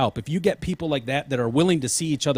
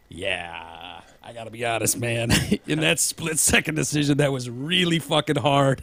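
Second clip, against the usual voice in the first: rueful admission. Retold or imitated. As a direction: admit it was hard, a little abashed. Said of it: "And he's like".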